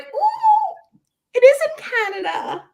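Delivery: very high, loud excited coo; delighted realization. Retold or imitated. Realizing the film is set in Canada, high delighted squeal. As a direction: squeal it high with sudden recognition.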